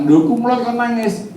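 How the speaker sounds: lightly acted high-pitched disbelief; imitated puzzled onlooker. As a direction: mimic a bystander's high, baffled question. Menirukan komentar orang yang heran. (menirukan orang heran dengan nada tinggi)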